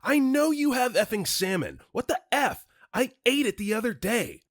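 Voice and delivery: high, loud outraged scream. Acted. Caller screaming at the worker over missing salmon, screaming.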